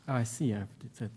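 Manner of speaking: soft, low, quick self-talk; muttered aside. Real, not acted. Presenter seeing what he did, muttering low and fast. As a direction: murmur it quickly to yourself. Aside on finding own slip.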